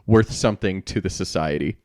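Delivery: ordinary delivery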